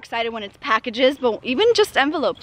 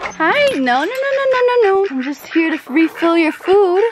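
much higher, quick no-no-no shooing the chickens; sing-song shooing of eager hens; high pet talk to chickens; 6 semitones higher, 4 dB louder than usual. Real, not acted. Chickens rush her at the feeder.

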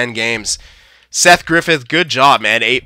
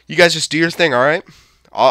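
normal talking voice

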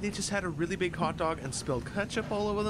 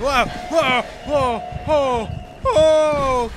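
loud repeated whoa of surprise; startled whoa; 5 semitones higher, 9 dB louder, much slower than usual. Real, not acted. Gamer startled by something in the dark. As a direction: jump back startled.

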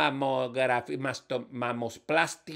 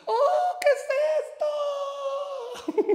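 astonished wonder. Acted. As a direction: marvel at it in a high, stretched voice. Woman amazed on arriving in heaven.

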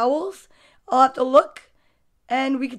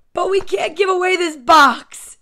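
put-on higher, louder protest of a child; pleading boy; 5 semitones higher, 8 dB louder than usual. Boy begs mother to keep the box, emphatic plea. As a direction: plead loudly as a kid keeping a treasure.

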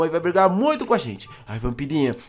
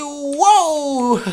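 excited whoop; 7 semitones higher, 5 dB louder than usual. Acted. Dives into the water.

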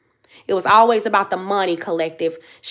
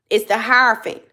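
usual speaking voice

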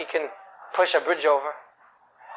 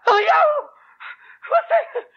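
very high, loud shriek; calling out; 4 dB louder than usual. Acted. Panicked mother calls her husband, calling out. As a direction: holler for him in a hammed-up screech. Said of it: "she calls her husband".

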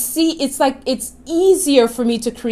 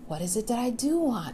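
much softer, calm and simple; gentle; 13 dB quieter than usual. Shown as a demonstration. The right way for viewers, real simple and soft. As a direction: breathe the question out gently. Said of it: "Real simple, real gentle".

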